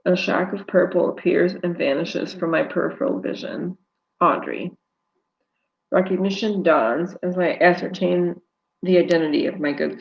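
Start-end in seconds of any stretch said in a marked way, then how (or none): none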